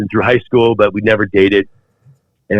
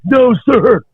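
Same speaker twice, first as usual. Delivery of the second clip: screamed refusal. Retold or imitated. Recruit defies the drill instructors, yelling back. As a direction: refuse at the top of your lungs. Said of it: "I'm screaming".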